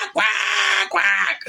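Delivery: high squeaky mock quack quack; playful high-pitched bird squawk imitation; ostrich quacks. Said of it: "squawking directly in your face".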